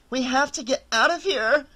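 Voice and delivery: high, panicked cry to flee; panicked high-pitched cry. A narrator's reading. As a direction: beg to get out, on the edge of hysteria. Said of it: "Laura cried".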